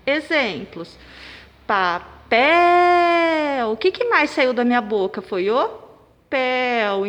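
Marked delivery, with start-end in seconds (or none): 1.7-3.7 Papel with PEL held long and loud; pa-PEEEL, last syllable sustained high and loud; stressed syllable exaggerated. Shown as a demonstration. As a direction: call out Papel, stretching the final pel. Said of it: "o pel é a sílaba tônica".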